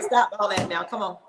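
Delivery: ordinary talk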